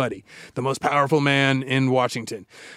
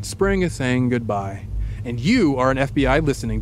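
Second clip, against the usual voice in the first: unhurried story voice painting the scene; lightly hushed storyteller; scene-setting narration; 3 semitones lower than usual. Acted. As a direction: set the scene for the listener, easing down. Host walks listener into scene.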